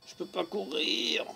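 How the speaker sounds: high, plaintive gamer whine; hammed-up high moan; mock complaint. Mock complaint about the game.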